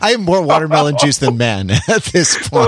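talking through laughter; laughing. Real, not acted. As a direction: laugh helplessly while admitting it. Laughing along at the joke.

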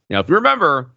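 normal talking voice

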